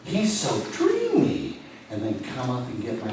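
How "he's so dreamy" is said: much higher, slow dreamy sigh; swooning fan. Retold or imitated. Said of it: "nod your head".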